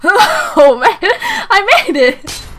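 high, loud triumph with laughter; breaking into a triumphant cry; elated. Real, not acted. Elated at succeeding in game.

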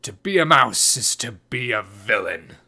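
forceful verdict from an indignant man; loud masculine indignation; angry man. A narrator's reading. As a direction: pronounce it with angry disgust, in character. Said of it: "said a man angrily".

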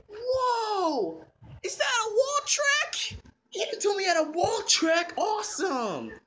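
put-on high-pitched excited kid; thrilled, squealing amazement; amazed. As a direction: gasp it out in delight. Child discovers a toy track.

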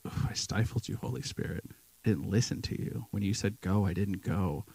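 soft, breathless, ashamed confession; guilty whisper. Pretend. Imagined guilty confession to God.

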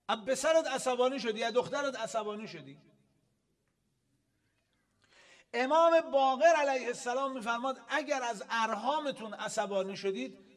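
loud, high preaching voice; forceful preaching. Real, not acted. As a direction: proclaim it to the whole congregation. Preaches at raised volume.

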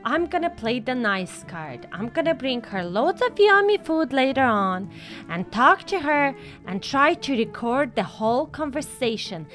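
low, measured plotting voice; dropped pitch, conspiratorial and deliberate; scheming. Acted. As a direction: lay out a sly plan confidingly. Mother lays out a sly plan.

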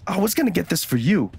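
high-pitched, happy objection; excited protest. Acted. Friend who planned the same gift, excitedly protesting. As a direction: protest in delighted disbelief.

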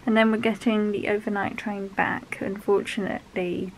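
ordinary talk

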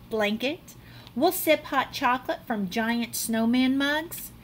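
ordinary delivery, nothing marked

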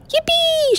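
high, squealing cheer; shout. Acted. George cheering as he meets a monster, high and gleeful. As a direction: cry out in delight. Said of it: "shouted George".

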